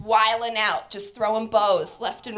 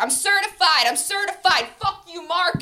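mock yell; 7 semitones higher, 4 dB louder than usual. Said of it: "he's like".

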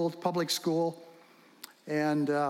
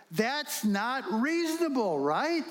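mock incredulous; 6 semitones higher, slower than usual. Real, not acted. Preacher mocks the idea.

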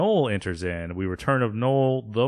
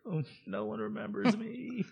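very high, small, soft whimper; pitiful mock self-pity; 12 semitones higher, 9 dB quieter than usual. Forgotten Roz feels sorry for herself, tiny mock whimper. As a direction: whimper it softly, pitched way up. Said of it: "And Ross is just like".

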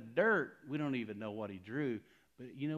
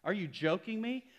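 high, disbelieving retort; incredulous; 7 semitones higher, 6 dB louder than usual. Retold or imitated. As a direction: scoff in disbelief, pitched up. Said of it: "I'm like".

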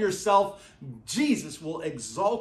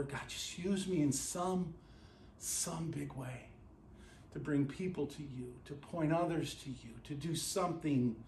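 hushed pleading prayer; 5 semitones lower, 11 dB quieter than usual. Acted. Acted cry out in prayer.

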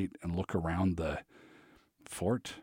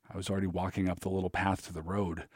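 usual speaking voice

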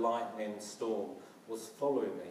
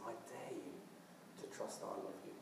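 hushed whisper, close beside you; 2 semitones lower, 11 dB quieter, faster than usual. Acted. Jesus leaning in to whisper to you, soft and intimate. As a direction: breathe it as if sitting right next to them.

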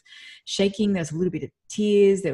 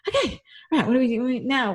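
eager energy; 7 semitones higher, 4 dB louder than usual. Retold or imitated. Retold eagerness after the talk.

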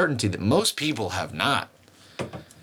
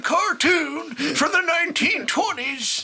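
high, slow cartoon shout; old-timey cartoon prospector, strained. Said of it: "I sound like a cartoon".

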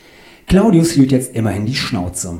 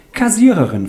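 high, sweetly sing-song voice; fluting; 11 semitones higher, 4 dB louder than usual. A narrator's reading. Andrea tells her job, high and fluting. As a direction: flute it out up high. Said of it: "flötete Andreas".

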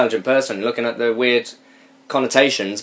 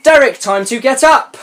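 higher sing-song call to wake him; calling brother; 9 semitones higher, 3 dB louder than usual. Acted. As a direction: call up the stairs at a twin. Voicing girl calling brother.

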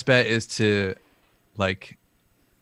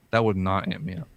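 normal talking voice

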